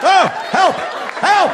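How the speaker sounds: high, helpless yelps; mock cry for help. Sneezer about to crap his pants, high panicked yelps.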